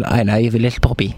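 low, quick put-on statesman; Michael D Higgins. Shown as a demonstration. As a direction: drop the voice for a mock presidential line. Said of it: "All right, say it".

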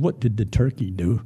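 low, halting parrot apology; humbled parrot, hesitant and low; half-frozen parrot. Acted. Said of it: "you know, half frozen".

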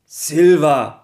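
calling the name gently. Acted. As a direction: call the name out, not too loud. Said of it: "rief eine leise Stimme".

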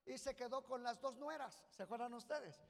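ordinary talk

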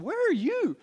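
suddenly worried husband; surprised. Retold or imitated. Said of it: "But then I was like".

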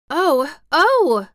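high, loud delighted exclamation; cry of delight. A narrator's reading. Colin hears Dickon is coming, delighted cry. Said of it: "Colin cried out in delight".

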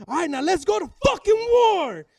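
high, full-voiced rallying shout; imitated battle cry. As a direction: roar it like a fighter charging out. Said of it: "He goes".

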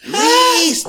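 loud high yell of Luis; yelling a name. Retold or imitated. Retelling the kidnapping, he yells for his brother. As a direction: shout Luis at the top of the voice. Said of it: "empiezo a gritar".

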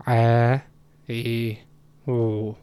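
"Ah, eh, oh" held long after a throaty ayn; long ayn vowels. Shown as a demonstration. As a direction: sustain each of "Ah, eh, oh" on a level tone. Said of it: "even a long I and a long O".

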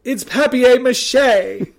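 "papier-mâché" said slowly, high and loud in a posh British way, with a laugh; mock British correction. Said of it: "It's like".

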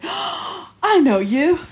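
high, slow, amazed; delighted recognition. Retold or imitated. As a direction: gasp then recognise a friend. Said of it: "I'm always like".

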